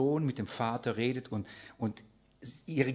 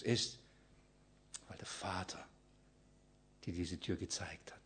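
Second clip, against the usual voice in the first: much softer and lower, drawn close like a secret; hushed and tender; 4 semitones lower, 6 dB quieter than usual. Acted. Shepherd speaking tenderly to his friend, hushed and low. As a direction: speak it softly as a loving promise.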